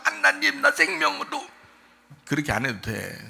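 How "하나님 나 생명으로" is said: high-pitched mock sobbing plea; whiny crying prayer. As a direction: imitate someone blubbering to God. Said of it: "막 울면서 막 징징 짜면서". (징징 짜며 우는 기도 흉내)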